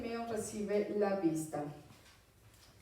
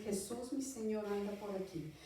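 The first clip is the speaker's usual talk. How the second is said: much softer, as if thinking aloud; murmullo para sí; 9 dB quieter than usual. Retold or imitated. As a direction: drop right down, a private realization. Imagina al ciego reconociendo a Jesús. (en voz baja, como para sí)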